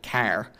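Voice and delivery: "car" with a low back vowel and the R still sounded; back vowel, R kept. Shown as a demonstration. Showing a Canadian sounding car. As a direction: keep the R but push the vowel back, a little Canadian. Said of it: "pronounce car with a lower back vowel but keeping the R".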